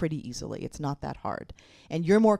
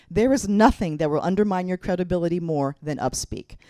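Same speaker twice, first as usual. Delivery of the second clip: usual speaking voice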